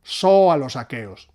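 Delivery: So a los aqueos, slow, accents on so and que; stress on So a los aqueos. Shown as a demonstration. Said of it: "Eso sería un dáctilo y un". («So a los aqueos», lento, acentuando so y que)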